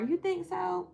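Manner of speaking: high, pleased question; flattered surprise. Real, not acted. To the viewer praising her voice, a flattered high question.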